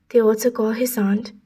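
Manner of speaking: ordinary delivery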